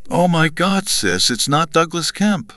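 very high-pitched, scandalized cry; shocked exclamation. A narrator's reading. Sister reacting to the lover's name, high shocked exclamation. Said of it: "exclaimed Betty's sister".

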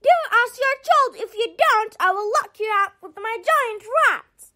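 high, fast, bossy scolding; screamed threat. A narrator's reading. Leather Queen threatening Chip with her rat, high angry scolding. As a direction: scold and threaten, pitched well up. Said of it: "screamed the Leather Queen".

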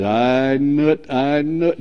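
quiet smug "I knew it"; smug gloating. Retold or imitated. A hint of gloating at a rival's misfortune. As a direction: savor it with a little smile. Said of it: "a little smile comes to my face".

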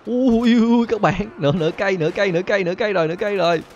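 very high, loud alarmed cries; panicked yelps. Real, not acted. Losing half his health, high panicked cries.

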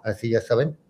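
usual speaking voice

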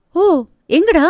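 high, eager surprised question. Acted. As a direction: perk up with excited surprise. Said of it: "வியப்பாய் பார்த்தவள்".

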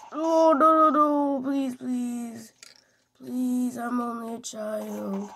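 playful fake-scared begging; mock panic. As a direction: beg like a helpless kid, jokingly. Mock pleading while getting attacked.

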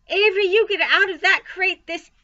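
high, loud scolding order; commanding. A narrator's reading. Mother to her son in the crate, commanding. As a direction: order the child out at full voice. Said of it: "commanded his mother".

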